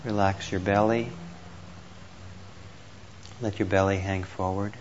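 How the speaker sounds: normal talking voice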